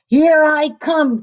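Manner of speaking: wolf announcing his attack down the chimney; wolf crying out. A narrator's reading. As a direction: bellow it from the roof. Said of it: "cried the wolf".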